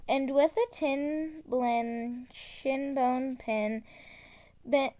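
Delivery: usual speaking voice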